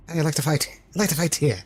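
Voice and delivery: high, loud mock Irish traveller accent; imitating Brad Pitt's Irish Pikey. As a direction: quote the boxer film in a thick Irish accent. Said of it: "Plays the Irish Pikey".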